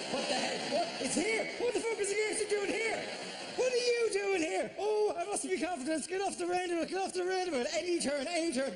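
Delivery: high, frantic, flustered voice; panicked driver. Acted. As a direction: act out losing your nerve at the wheel.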